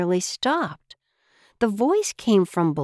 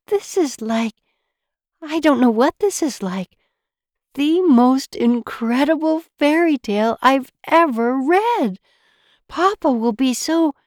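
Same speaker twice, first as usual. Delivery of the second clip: higher, louder breathless wonder; amazed excitement; 6 semitones higher, 4 dB louder than usual. Acted. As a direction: gush in amazement, building as you go. Belle marvels at enchanted tea things.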